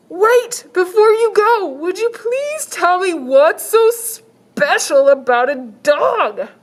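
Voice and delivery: high, slow salesman pitch; shelter man voice. Acted. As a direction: sell it like an eager shopkeeper.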